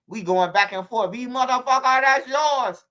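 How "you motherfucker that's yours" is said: higher, louder, put-on foreign driver voice; imitating the cab driver. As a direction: play the furious cab driver shoving the blame. Driver voiced in retold argument.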